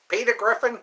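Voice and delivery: high cartoon voice with a signature laugh; Peter Griffin. Listing Family Guy characters, cartoon dad impression.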